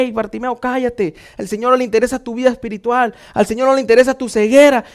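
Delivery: normal talking voice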